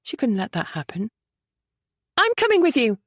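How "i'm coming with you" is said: higher, louder outburst; bursting out. A narrator's reading. Lady insisting she goes along, urgent outburst. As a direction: blurt it out urgently. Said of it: "she burst out".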